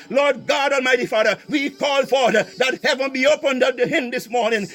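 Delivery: ordinary talk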